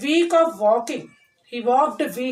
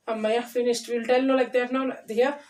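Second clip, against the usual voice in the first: plain reading voice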